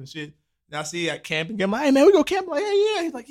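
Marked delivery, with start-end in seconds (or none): 1.8-2.5 high, loud eager invite; excited. Retold or imitated. Excited invite about camping. 2.6-3.0 high, quick eager yeahs. Retold or imitated. Eager agreement in retold chat.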